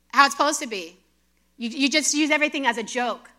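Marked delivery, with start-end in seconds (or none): none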